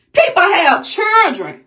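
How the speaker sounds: upset shout. Real, not acted. Outraged pleading about children.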